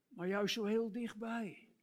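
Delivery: ordinary delivery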